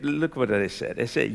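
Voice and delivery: ordinary talk, nothing marked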